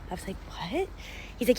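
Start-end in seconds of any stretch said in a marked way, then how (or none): 0.5-0.9 high, soft incredulous "what?"; mock disbelief, pitched up and quiet; taken aback. Customer startled by the broker's question, taken aback.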